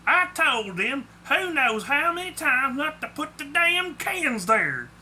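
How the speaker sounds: high, irritated Southern rant; angry. A narrator's reading. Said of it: "he said angrily".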